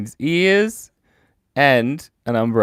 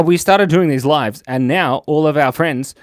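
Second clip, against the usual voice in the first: normal talking voice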